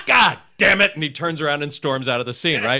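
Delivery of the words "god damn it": frustrated curse. Retold or imitated. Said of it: "and Ike goes".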